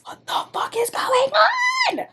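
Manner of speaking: high, disbelieving cry of shock; shocked outburst. Real, not acted. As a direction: shriek it out in sheer disbelief. Reacting to the book's twist.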